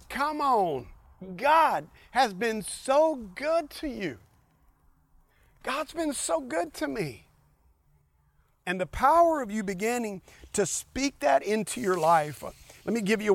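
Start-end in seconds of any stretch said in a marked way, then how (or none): 0.1-7.3 high, drawn-out joyful urging; excited encouragement. Retold or imitated. Psalmist cheering his own soul, high and excited. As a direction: lift it up, urge him on with joy. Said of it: "What he's saying is".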